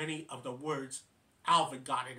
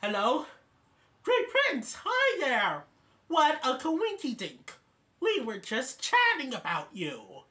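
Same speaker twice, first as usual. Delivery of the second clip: higher and louder, over-eager; excited chipmunk on phone; 9 semitones higher, 6 dB louder than usual. Retold or imitated. Alvin greeting the Great Prince on the phone, high and excited. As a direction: gush into the phone in a squeaky voice.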